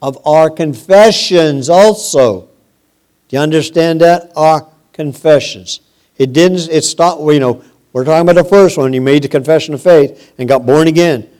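quick, urgent sermon drive; fiery preaching. Acted. Preacher urging his flock about confessions, fervent. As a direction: press the point home on the congregation.

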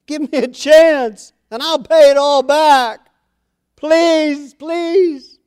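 high, loud pleading servant; begging servant. Acted. A servant begging his king, high and loud.

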